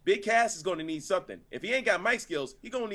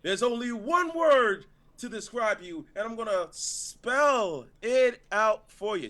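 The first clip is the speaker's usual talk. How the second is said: high, slow, dramatic delivery; too much emotion; 3 semitones higher, slower than usual. Acted. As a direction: draw it out with big showy feeling. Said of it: "you've already had more emotion".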